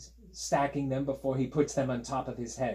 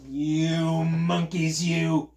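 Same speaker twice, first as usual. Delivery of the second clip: loud, long-drawn scolding; slow, booming telling-off for children; scolding peddler; 3 semitones higher, 6 dB louder, much slower than usual. A narrator's reading. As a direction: draw out each word in loud indignation. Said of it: "shaking a fist at them".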